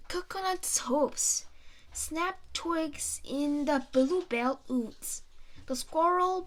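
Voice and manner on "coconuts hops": usual speaking voice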